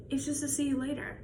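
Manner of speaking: usual speaking voice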